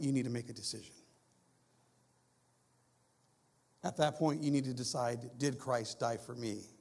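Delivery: low, hushed preaching voice; solemn preaching. Real, not acted. Preacher asks congregation to decide about the cross, solemn. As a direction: let each question sink in softly, dropping away at the end.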